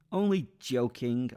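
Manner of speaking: laughingly. Acted. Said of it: "I laughingly said".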